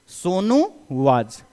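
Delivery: Sonu was drawn out slowly; slow classroom dictation. Shown as a demonstration. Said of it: "Sonu के साथ वर लगेगा क्या".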